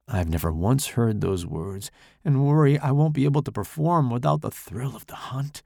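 ordinary delivery, nothing marked

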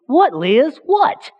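usual speaking voice

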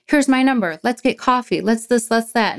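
high, bright mimicked offers; chirpy rush of invitations from meeting members; eager newcomer greeters. Mimicking eager meeting members, pitched high.

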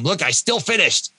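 mock showing off to the family; look-at-me boast.

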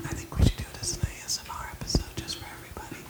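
hushed whisper to the listeners; soft, unvoiced ASMR suggestion. Shown as a demonstration. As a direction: whisper it softly, right up close. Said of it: "even my whisper sounds like".

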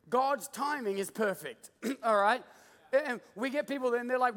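ordinary talk

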